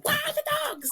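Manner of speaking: put-on a kid's sudden, very high squeal of delight; excited child squeal. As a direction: squeal it like a thrilled little kid spotting dogs. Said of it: "they get excited and they go".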